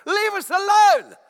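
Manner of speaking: huge high drawn-out shout; demonic yell. Acted. Demon in the man yells out at Jesus, acted loud yell. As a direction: bellow it like a possessed man. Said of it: "here's what he yells out".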